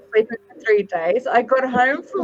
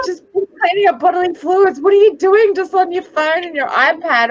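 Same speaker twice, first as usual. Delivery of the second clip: higher, fast, mock exasperated rant; exasperated mother scolding phone-bound family, higher and quick; retold outburst; 6 semitones higher than usual. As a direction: replay losing your temper at the family, quick and high. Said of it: "I just lost my temper".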